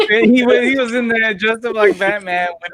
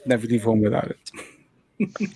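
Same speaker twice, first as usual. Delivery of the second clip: slow, lower, softer slogan read; 6 semitones lower, 6 dB quieter, much slower than usual. Pretend. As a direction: deliver it like a TV ad. Credit card ad slogan.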